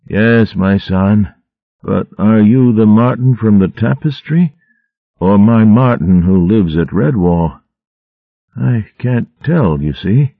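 put-on gently confused old mouse asking his friend; frail old abbot. A narrator's reading.

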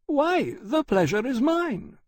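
very high, gracious mother's voice; gracious lady. Acted. Mother greets her son's dragon, graciously.

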